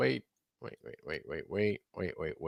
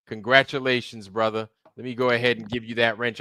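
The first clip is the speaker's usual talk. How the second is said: ordinary talk, nothing marked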